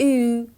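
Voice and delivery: "او" said as a long German ü; German u-umlaut. Shown as a demonstration. Teacher models u-umlaut for learners, "او" as long ü. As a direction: say "او" as ü, an ee with rounded lips. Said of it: "به این شکل".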